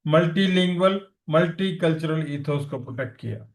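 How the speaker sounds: usual speaking voice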